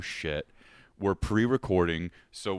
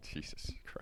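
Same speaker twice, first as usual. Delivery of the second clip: laughing; 15 dB quieter than usual. Real, not acted. Reacting to the yelled start.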